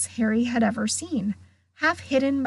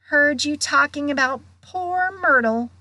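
teasing poltergeist; 5 semitones higher than usual. Acted. Peeves teases about Myrtle.

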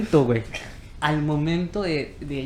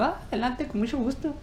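polite host voice; 8 semitones higher, 5 dB quieter than usual. Retold or imitated. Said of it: "Y me dijo".

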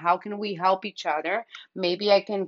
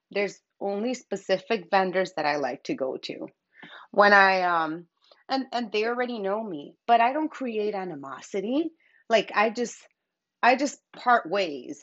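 ordinary delivery

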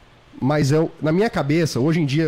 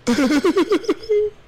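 laugh; 13 semitones higher than usual. Real, not acted. Group laughing at story.

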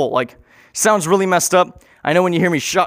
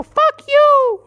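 much higher, loud ghostly yell; Thomas's ghost shouting much higher and louder; 5 dB louder than usual. Retold or imitated. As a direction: hurl it from far up and loud.

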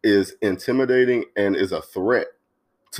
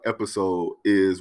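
usual speaking voice

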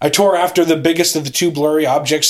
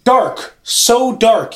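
higher, drawn-out impatient correction; exasperated, words set firmly apart; 6 semitones higher, much slower than usual. Acted. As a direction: spell it out slowly for an idiot. Correcting brother's misreading.

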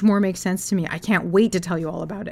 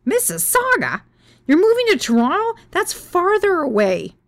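"Mississauga?" shrieked much higher and louder, falling; shocked outrage; 11 semitones higher, 5 dB louder than usual. Retold or imitated. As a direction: screech "Mississauga" in disbelief. Shocked at the job being in Toronto.

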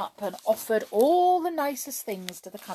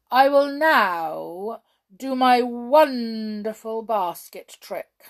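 louder, slow and grand announcement; loud and haughty; 4 semitones higher, 10 dB louder, slower than usual. A narrator's reading. Conjurer announcing his trick to the King, loud and haughty. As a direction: proclaim it with lofty pride. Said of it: "in a very loud and haughty voice".

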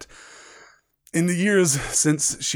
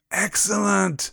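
higher, drawn-out cheer; delighted twins; 6 semitones higher, 3 dB louder, slower than usual. Acted. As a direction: relish the word like a mischievous boy. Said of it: "said Fred and George together".

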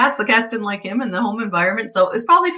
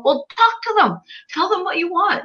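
much higher, excited urging; eager retold advice; 10 semitones higher than usual. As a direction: pitch it up high, eagerly coaxing the client. Retold advice to cat owner.

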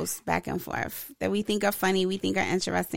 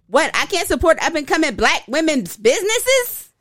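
mock caps yell; 6 semitones higher, 4 dB louder than usual. Shown as a demonstration. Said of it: "capitals are loud".

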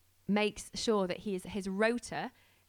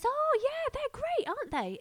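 much higher, loud bright gush; chirpy neighbour; 6 dB louder than usual. Retold or imitated. Neighbour Tamsin telling the driver about them, much higher and gushing. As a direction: squeal it like a delighted neighbour.

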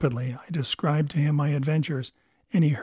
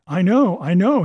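higher, louder burst of agreement; urgent, raised storybook cry; impassioned cry; 4 semitones higher, 7 dB louder than usual. A narrator's reading. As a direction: cry it out, brushing the objection aside. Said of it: "he cried".

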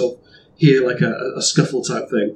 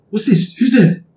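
high, alarmed character voice; startled, calling out into the dark; startled NPC; 8 semitones higher, 5 dB louder than usual. Acted.

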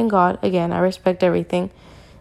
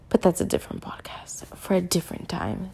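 whisper; 10 dB quieter than usual. Real, not acted. Aside about another podcast.

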